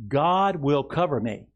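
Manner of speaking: slow, emphatic words for the church to echo; slow declaration to repeat. Shown as a demonstration. Pastor leading the congregation, slow and firm. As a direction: lead it slowly and firmly. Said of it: "Say this with me".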